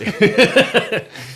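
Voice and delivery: loud squealing laugh; high burst of laughter. Real, not acted. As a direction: crack up laughing.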